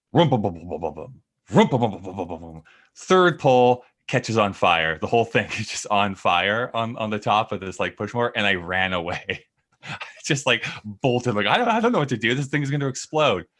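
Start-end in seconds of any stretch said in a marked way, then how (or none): 0.1-2.6 engine sputter imitation. Imitating mower starting up. 11.5-13.5 panicked. Retold or imitated. Retelling panic at burning mower.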